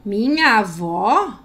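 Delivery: an offended, slow "Minha avó?"; indignant question. Shown as a demonstration. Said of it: "Ponto de interrogação".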